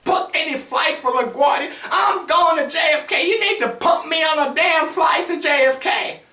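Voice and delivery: hammed-up, high, loud ranting; yelling old woman. Old woman demanding a flight to JFK, yelling. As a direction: holler in a very high voice, outraged. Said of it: "she's having a field day".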